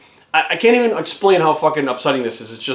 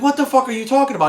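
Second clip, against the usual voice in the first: retold baffled outburst, pitched up; dumbfounded; 7 semitones higher, faster than usual. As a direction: throw it back in disbelief. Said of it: "I was like, I was dumbfounded".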